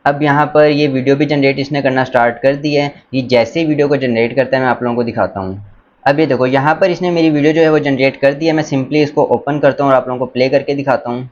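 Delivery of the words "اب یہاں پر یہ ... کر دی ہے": usual speaking voice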